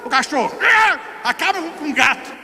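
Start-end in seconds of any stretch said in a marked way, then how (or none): 0.6-1.0 dog snarl. Retold or imitated. Dog attacking cat.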